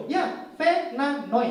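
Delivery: "Fernando" slowly repeated as "Fe-nan-noy"; mock Vietnamese accent, obediently syllable by syllable; Vietnamese-accented name. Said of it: "diubah jadi nama Hanoi". ("Fernando" pelan berlogat Vietnam)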